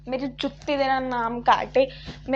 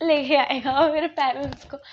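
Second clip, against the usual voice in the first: defiant retort; 2 semitones higher, 7 dB louder than usual. Retold or imitated. Defiant inner retort to teacher.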